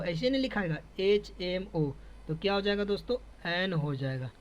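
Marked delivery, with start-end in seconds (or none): none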